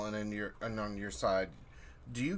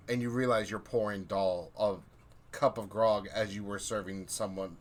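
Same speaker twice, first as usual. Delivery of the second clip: ordinary talk, nothing marked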